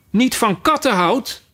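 the mouse shrieks back in outrage; shrill outraged mouse. A narrator's reading. The offended mouse shrilly repeats Alice's words. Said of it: "schreeuwde de muis met schrille, opgewonden stem".

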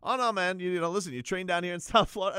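lightly hammed-up fake sincerity; insincere reassurance. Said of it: "such an insincere thing to say".

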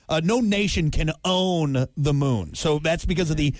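ordinary delivery

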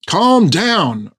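high, pressing plea to settle; urging calm. A narrator's reading. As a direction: urge them to calm down, pitch jumping up. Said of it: "urged Fred".

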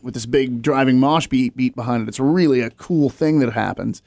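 ordinary delivery

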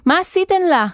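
high, panicked accusation; distressed, accusing outcry; accusing cry. A narrator's reading. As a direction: cry it out in alarm, pitched well up. Panicked boy accusing the women.

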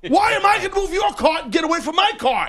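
laughing indignant shout. Retold or imitated.

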